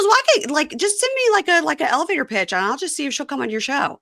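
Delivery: breezy, overconfident promise; imitating friend. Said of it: "He goes".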